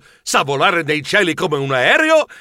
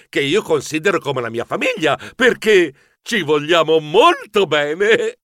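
ordinary delivery

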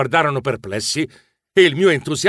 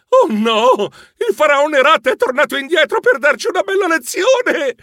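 high, loud cry of alarm; panicked shriek; 12 semitones higher, 3 dB louder than usual. A narrator's reading. Narrator fears the pharaoh Rat is back, panicked shriek. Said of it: "Io strillai".

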